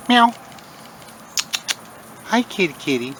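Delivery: high coo to the cat; calling to the cat; high cat greeting. Real, not acted.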